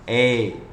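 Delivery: ए long with the tongue pulled out; stretched eh. Shown as a demonstration. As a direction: draw ए out long. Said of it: "टंग को ज़्यादा खींचिए". (जीभ खींचकर लंबा ए)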